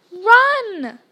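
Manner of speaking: loud, high yell of alarm; one long yelled word. A narrator's reading. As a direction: shout it out as an urgent order. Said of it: "Harry yelled".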